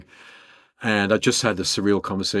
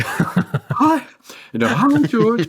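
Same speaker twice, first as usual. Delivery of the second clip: jumps way higher, squeaking with nervous laughter; squeaky star-struck voice; 14 semitones higher, 6 dB louder, much slower than usual. Retold or imitated. Answering George on the phone, star-struck high squeak. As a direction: voice cracks up high, too excited to stay cool. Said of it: "My voice changed".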